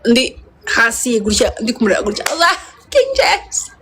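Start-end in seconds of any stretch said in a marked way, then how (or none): 2.3-3.7 high, slow starstruck gasp of the name; starstruck squeal. Retold or imitated. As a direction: squeal it like you can't believe he's there. Starstruck seeing King James.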